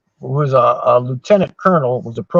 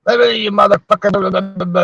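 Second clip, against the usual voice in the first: higher, hammed-up cursing; mock swearing general; 5 semitones higher than usual. The general cursing the young MP at his door, mock angry. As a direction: rattle off abuse like a raging general.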